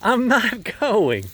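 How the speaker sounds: laughing refusal. Retold or imitated. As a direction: refuse him, laughing as you say it. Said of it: "And I'm like".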